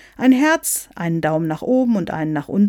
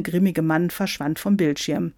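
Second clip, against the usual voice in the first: ordinary delivery, nothing marked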